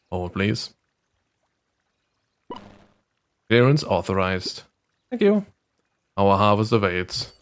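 low, slow security voice; robotic guard voice. Acted. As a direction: speak like a cold guard robot.

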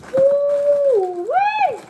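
long, high "woo-wee"; delighted whoop. Real, not acted. Smelling the cereal, delighted whoop.

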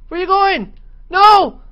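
panicked shout; yelling. Real, not acted. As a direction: yell out in alarm. Friend's pet running into danger.

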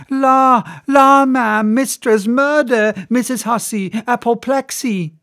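high, loud panicked cries; frantic high calls for help; crying out. Acted. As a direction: maid shrieking for her mistress. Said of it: "with all these cries".

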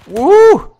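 loud, high woo; excited whoop. Real, not acted. Gamer whooping as he opens fire with the machine gun. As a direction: whoop with glee.

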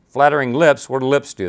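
ordinary delivery

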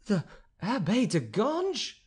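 first stunned cry of horror. Acted. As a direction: recoil as you repeat his name. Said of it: "cried the Countess in horror".

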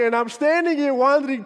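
ordinary talk

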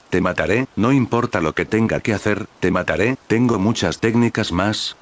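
ordinary delivery, nothing marked

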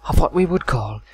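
hushed nature documentary narrator; David Attenborough whisper. Pretend. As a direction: whisper like a wildlife film voiceover. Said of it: "Tomorrow on David Attenborough's".

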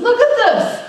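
excited "look at this" to mom and dad. Retold or imitated. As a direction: show off the find, thrilled. Excited showing parents the verse.

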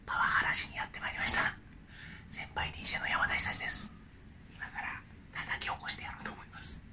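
hushed sneaky whisper. Acted. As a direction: whisper so he stays asleep. Whispered prank wake-up intro.

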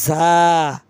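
জা said firm and hard like জ; শক্ত জা. Shown as a demonstration. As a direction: make জা hard. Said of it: "শক্ত হবে".

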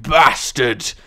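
slow, loud Scottish relish; Scottish accent. Shown as a demonstration. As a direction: stretch it out in Scottish. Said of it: "best said in a Scottish accent".

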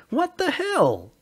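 high, slow, stunned shout. Acted. Sully, punched by Calla, shouts high in shock. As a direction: yell it out, reeling from the punch. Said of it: "Sully shouted".

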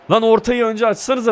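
high, loud frustrated yell; angry yell. Real, not acted. Gamer yelling at teammates to cross first, angry. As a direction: bark at the teammates.

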